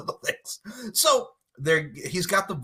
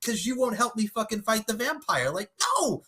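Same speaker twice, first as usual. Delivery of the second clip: indignant squeal; 8 semitones higher, 3 dB quieter than usual. Acted. Scolding the friend who won't fight the vampire, indignant squeal. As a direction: squeak out the outrage, higher and higher. Mock rant at friend continues.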